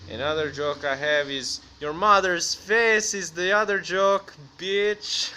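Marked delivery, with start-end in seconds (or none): 1.8-5.3 slow start, high and loud; loud mock insult. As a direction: belt out the punchline as a hammed-up taunt.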